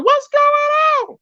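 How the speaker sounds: very high, slow, stretched squeaky voice; chopper voice. Pretend. Streamer asking chat about StreamYard, in a squeaky chopper voice. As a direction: drag it out in a high cartoon squeak. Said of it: "chopper voice".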